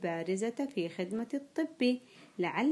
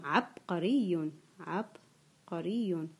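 slow dictation; 3 semitones lower, much slower than usual. Shown as a demonstration. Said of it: "سوف أنطق الكلمة".

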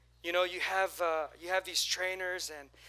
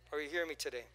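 ordinary talk, nothing marked

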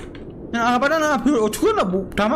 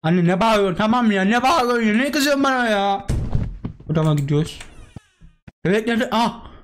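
ordinary talk

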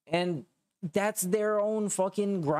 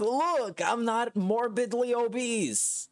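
high, slow mocking whine; mocking impression; 7 semitones higher, slower than usual. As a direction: parody him in a silly high voice. Mocking Phil's denial.

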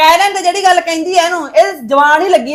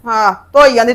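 low, dismissive repeat; scornful echo; 6 semitones lower than usual. Acted. Mother echoes daughter's washing excuse, scornful and low. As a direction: throw her words back at her, pitched down.

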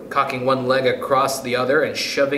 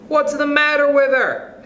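high-pitched eager pupil; eager question; 13 semitones higher, 4 dB louder than usual. A narrator's reading. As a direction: ask it high and keen, like an excited schoolkid. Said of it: "asked Roy Hart eagerly".

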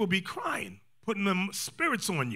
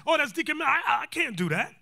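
higher-pitched, stammering reluctance; reluctant friend; 7 semitones higher than usual. Acted. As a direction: act out balking at judging a friend. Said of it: "I'm looking at it".

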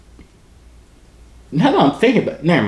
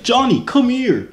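high, drawn-out mock parent yell; imitation of a parent hollering; parent calling a child; 13 semitones higher, 3 dB louder, slower than usual. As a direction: call the kid over in a high put-on voice. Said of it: "when your parents calling you".

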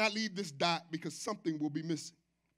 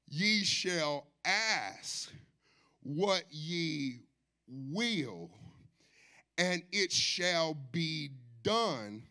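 solemn scripture reading; 3 semitones lower, much slower than usual. Real, not acted. Preacher reads verse seven slowly and reverently to the church. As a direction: read each word slowly and reverently.

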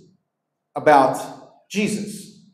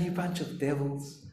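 much softer, hushed and sweet delivery; mock gentle; 5 semitones lower, 14 dB quieter than usual. Shown as a demonstration. Preacher asking whether Jesus rebuked people this way, mock gentle. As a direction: say the insult as a tender murmur. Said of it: "talking like that".

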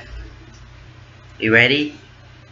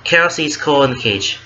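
ordinary delivery

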